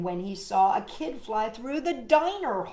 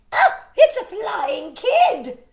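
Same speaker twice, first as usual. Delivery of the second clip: high, loud, slow shriek of alarm; scream; 11 semitones higher, 13 dB louder, slower than usual. Acted. Knitting woman spots the boy flying, screaming. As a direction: shriek it out in fright. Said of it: "and screamed".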